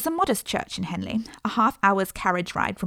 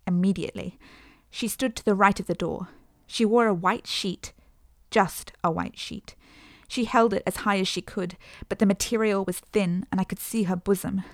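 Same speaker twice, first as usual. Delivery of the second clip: usual speaking voice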